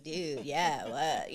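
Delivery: low, laid-back surfer drawl; lightly voiced surfer dude. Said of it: "one of 'em sounds like a surfer dude".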